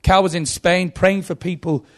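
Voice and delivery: ordinary talk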